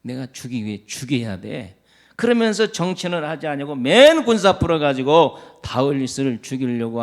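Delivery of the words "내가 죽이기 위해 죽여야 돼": a low, venomous vow to kill; murderous Saul. Acted. Preacher voices Saul's hushed murderous intent. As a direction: lower and softer, hissing threat. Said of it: "사울은 어떻습니까".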